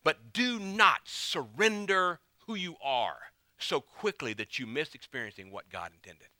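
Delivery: normal talking voice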